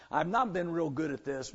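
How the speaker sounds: usual speaking voice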